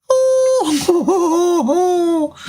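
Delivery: very high, delighted squeals of oh; squealing over a kitten. Real, not acted.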